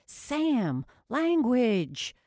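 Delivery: high, loud scolding. Acted. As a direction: call out a sharp rebuke. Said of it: "even harder not to laugh".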